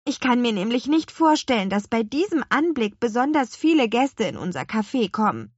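put-on a girl fretting lightly; worried. A narrator's reading. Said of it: "meinte Lea besorgt".

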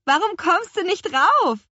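high, loud witch calling down; far-carrying high-pitched call. A narrator's reading. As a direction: shout the question down from high up. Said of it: "rief Petronella".

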